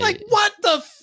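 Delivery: high shouted disbelief; mock outrage. Host mocking a condescending reply, high shouted disbelief. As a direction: blurt it in incredulous outrage.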